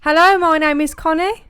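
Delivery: loud, high show-off introduction; over-bright demonstration of a podcast intro; mock-enthusiastic greeting. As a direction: belt out a big hammy hello. Showing co-host how to do it.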